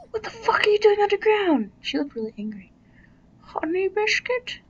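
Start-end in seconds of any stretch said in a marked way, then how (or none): 0.1-1.7 high, loud, fast startled yell; loud high shocked cry at the screen; shocked outburst. Real, not acted. 3.4-4.6 high cooing question; high pet-name coo. Real, not acted. Cooing at a game character.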